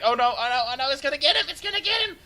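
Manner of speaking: high, loud panicky "oh no" chant; retold panic. Caster reliving watching the bomber approach, high panicked cries. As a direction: fret that the bomber will hit. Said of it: "I was like".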